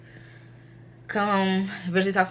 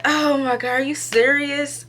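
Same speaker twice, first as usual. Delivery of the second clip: high, drawn-out incredulous question; retold shock, pitched up and stretched; shocked disbelief; 8 semitones higher than usual. Reacting to the tech saying boy, stunned.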